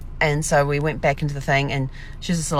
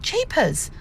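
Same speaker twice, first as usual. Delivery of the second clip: mild exasperation; 11 semitones higher than usual. Retold or imitated. Retold exasperated sigh.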